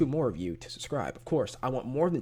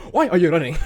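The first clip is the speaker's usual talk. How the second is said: loud, raised-pitch quote with laughter behind it; meme imitation; 5 semitones higher, 9 dB louder, faster than usual. YouTuber asking viewers about a meme, imitating it loudly. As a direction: shout it like the meme. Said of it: "y'all know that meme?".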